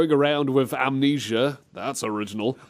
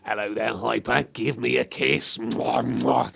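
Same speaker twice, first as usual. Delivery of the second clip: creepy admirer. Acted. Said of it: "don't talk like that to Hyper".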